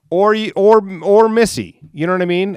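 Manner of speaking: loud high jab at the ladies; loud callout. Acted. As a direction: call them out loudly. Calling out the women.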